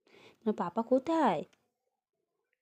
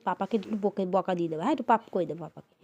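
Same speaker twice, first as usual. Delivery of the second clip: normal talking voice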